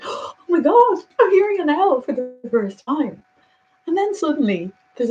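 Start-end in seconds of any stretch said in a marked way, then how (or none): 0.0-3.2 amazed delight. Retold or imitated.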